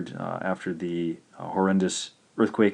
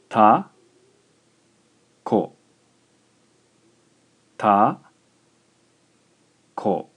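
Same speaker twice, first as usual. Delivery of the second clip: "Ta, ko" spaced slowly, first syllable louder; 6 dB louder, slower than usual. Shown as a demonstration. Teacher dictates a two-syllable word to students: slow syllables. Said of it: "I'll speak another Japanese word".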